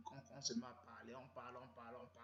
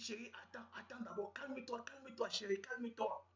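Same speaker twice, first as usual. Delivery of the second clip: soothing; 8 semitones higher, 4 dB louder than usual. Retold or imitated. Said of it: "Je dis".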